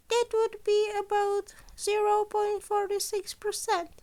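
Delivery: chirpy high principal voice, pleased with himself; Nezu, the principal. A narrator's reading. Principal offers a student an extra boon, high and bright. Nezu's voice in the story.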